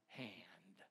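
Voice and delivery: sudden hushed whisper. Acted. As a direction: drop to a whispered last word. Whispered end of scripture.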